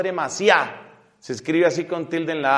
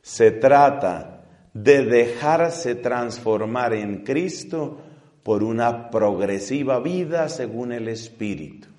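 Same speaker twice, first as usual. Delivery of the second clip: slow, deliberate reading of the papal text; slow solemn quotation from the Pope; 6 semitones lower, slower than usual. Shown as a demonstration. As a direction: read it out word by word, gravely.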